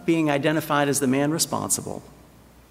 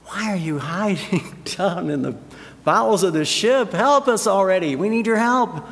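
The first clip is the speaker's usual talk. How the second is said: frustrated, insistent appeal; exasperated plea; 3 semitones higher than usual. Acted. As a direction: demand help like a fed-up sailor. Sailors' imagined plea to hiding Jonah.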